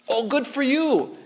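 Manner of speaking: high, drawn-out mock approval; mock congratulation. Pastor playing a reply to a friend's confession, mock congratulation. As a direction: slowly gush a hollow well-done.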